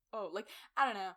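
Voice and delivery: ordinary talk, nothing marked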